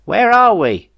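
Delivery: high, loud call across the ship. Acted. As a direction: call out to the cockpit. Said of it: "called Jeff".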